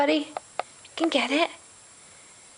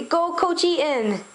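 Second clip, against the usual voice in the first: put-on slow cheer for baby; 6 semitones higher than usual. Shown as a demonstration.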